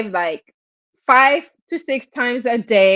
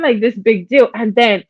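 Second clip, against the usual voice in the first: ordinary talk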